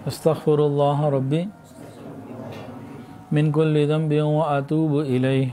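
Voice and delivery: slow, measured Arabic recitation in short phrases; leading repeat-after-me prayer. Real, not acted. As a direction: lead them word by word, drawn out, pausing for response. Leads congregation in repentance formulas.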